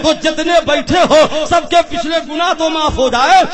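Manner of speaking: loud, fervent sermon climax; preaching at full force. Real, not acted. Preacher rousing the crowd about the throne, loud fervent preaching.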